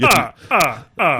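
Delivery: staccato vampire laugh; the Count. Acted. Podcast host warms up as the Count, staccato laugh. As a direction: count out a theatrical vampire cackle. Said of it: "we're going with whatever that vampire's".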